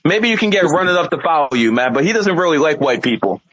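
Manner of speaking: ordinary delivery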